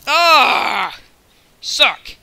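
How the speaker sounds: loud, high outburst; frustrated yell. Real, not acted. Gamer losing a fight, frustrated yell. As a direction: yell out as you lose. Hit in the game.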